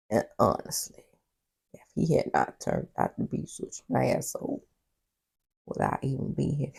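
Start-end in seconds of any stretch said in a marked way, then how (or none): none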